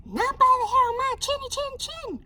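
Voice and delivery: very high, fast squeaky pig; high piggy refusal; little pig voice. Acted. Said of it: "cried the third little pig".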